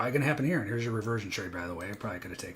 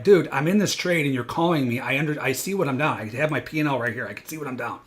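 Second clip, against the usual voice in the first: loud, higher, exasperated snap back at the caller; retold angry retort; 4 semitones higher, 12 dB louder than usual. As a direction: fire back like a stressed trader losing his mind. Retorting to caller mid-trade.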